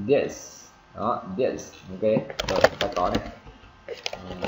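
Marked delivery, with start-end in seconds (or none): 0.1-1.7 "this" with a strong hissed ending; clear, non-stop S on "this"; sounded final S. Shown as a demonstration. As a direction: keep the S of "this" going. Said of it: "Cái âm S thì chúng ta phải bật ra".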